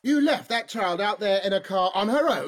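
loud, outraged accusation. Acted. Outraged at wife.

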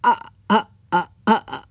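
frightened stammering; nervous uh-ing; trembling hesitation. A narrator's reading. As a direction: quaver helplessly, unable to answer. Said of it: "He tremoured and shivered".

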